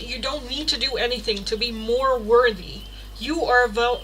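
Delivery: ordinary talk, nothing marked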